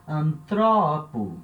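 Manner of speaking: antropo with TRO stretched and stressed; anTROpo, accent one syllable later; stress moved to second syllable. Shown as a demonstration. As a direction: lean hard on TRO in antropo. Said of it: "eu tenho a mudança de acentuação".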